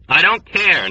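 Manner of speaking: put-on a desperate young man's shout. A narrator's reading. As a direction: shout it out, near breaking. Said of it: "Nately shouted desperately".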